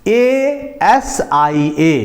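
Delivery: each letter of एएसआईए stretched out, very slow; spelled out slowly. Shown as a demonstration. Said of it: "एशिया". (धीरे-धीरे spelling)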